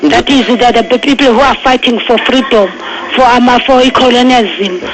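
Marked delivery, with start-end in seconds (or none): none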